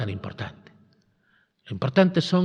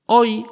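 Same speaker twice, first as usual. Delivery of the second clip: "Hoy" higher and louder, set apart; stress on Hoy; 8 semitones higher, 7 dB louder than usual. Shown as a demonstration. Preacher pointing out the Gospel's key word, stressed. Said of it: "Y es la palabra hoy".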